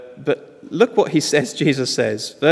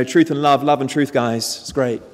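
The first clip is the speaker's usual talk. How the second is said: normal talking voice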